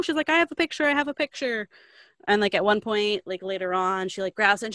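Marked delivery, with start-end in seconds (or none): 0.3-1.7 high-pitched, urgent retold plea; mock insistence. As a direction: insist in a raised, higher voice. Acted insistence of the woman.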